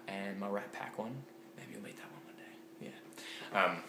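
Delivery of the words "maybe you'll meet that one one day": soft teasing whisper. Real, not acted. Vlogger teasing viewers about another mug, whispered. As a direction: breathe it as a little secret.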